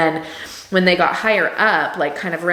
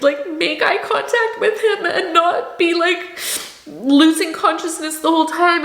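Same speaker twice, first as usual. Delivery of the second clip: choked up with joy; 10 semitones higher than usual. Real, not acted. Mother recalling first holding her baby, voice high and breaking with joy. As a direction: voice pitched way up, tearful and breaking with happy emotion.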